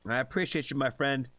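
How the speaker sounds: usual speaking voice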